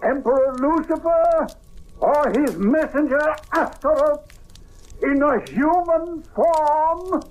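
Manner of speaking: slow, rising ritual chant, high and ringing; solemn incantation. Acted. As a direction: intone the summoning like a priest commanding a demon. Incantation summoning the spirit.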